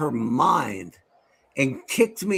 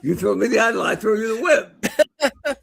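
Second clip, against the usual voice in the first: movie villain line; 5 semitones higher than usual. Pretend. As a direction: drag it out like the film. Answering Indiana Jones quote.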